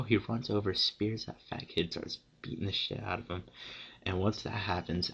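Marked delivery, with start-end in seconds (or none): none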